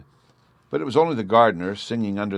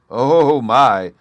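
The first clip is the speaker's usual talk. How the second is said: much louder stunned gasp; gasp of amazement; 5 semitones higher, 10 dB louder than usual. A narrator's reading. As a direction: blurt out in stupid amazement. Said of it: "was all he could gasp out".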